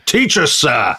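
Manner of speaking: a schoolboy's cheeky call back, lightly done; cheeky shout. Retold or imitated. Boy calling back cheekily to his teacher, mild mock shout. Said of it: "Churchill shouts out".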